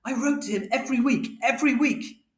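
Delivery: high, insistent protest; mother shouting. A narrator's reading. Mother defending herself to Father, high-pitched shout. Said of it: "And Mother shouted".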